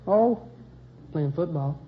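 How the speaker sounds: ordinary delivery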